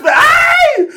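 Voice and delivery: high loud aye of pride; hype yell. Real, not acted. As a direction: shout it out, pumped up. Hyped about his serious face.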